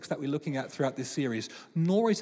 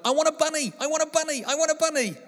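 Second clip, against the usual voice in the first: put-on much higher, louder childish chant; nagging little girl; 11 semitones higher, 4 dB louder, faster than usual. Father imitating his daughter Mia begging, high childish chant. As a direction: whine it like a relentless five-year-old. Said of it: "She's like".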